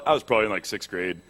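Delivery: very low, soft mock-macho voice; put-on deep voice. Man recalling his first shave in sixth grade, put-on deep voice. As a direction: drop right down like a self-styled beast. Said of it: "not gonna talk like that anymore".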